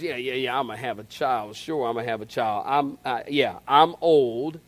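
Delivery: put-on low, soft, doubting old woman; scoffing old Sarah. Preacher voicing Sarah scoffing at God's promise, low and soft. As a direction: mutter it low like a disbelieving old wife. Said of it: "she goes".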